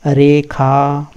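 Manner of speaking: very slow रेखा with a clear aspirated खा; slow recitation. Shown as a demonstration. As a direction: stretch रेखा as रे-खा. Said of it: "इसको रे बोलते हैं और इसको खा".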